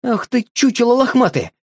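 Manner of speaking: high exasperated outburst; shouted in anger. A narrator's reading. Boy yells at the dog in frustration, high angry outburst. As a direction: blurt it out in exasperation. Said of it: "выкрикнул он в сердцах".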